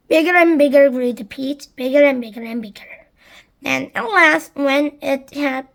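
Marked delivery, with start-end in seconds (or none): none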